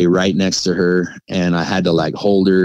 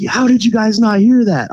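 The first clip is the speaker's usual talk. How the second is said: high, louder disbelief; pitched-up can't-believe-it; incredulous dad; 4 dB louder than usual. Retold or imitated. Dad can't believe the kids missed it, high disbelief.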